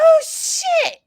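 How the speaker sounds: high, loud startled oh shit; shocked shout. Retold or imitated. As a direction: yelp in shock at being recognized. Actor's shocked reaction.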